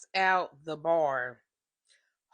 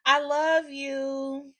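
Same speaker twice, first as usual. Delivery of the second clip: high, slow, syrupy mock "I love you"; mock sweet girlfriend; 7 semitones higher, 6 dB louder, much slower than usual. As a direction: coo it like the other woman. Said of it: "I hear her say".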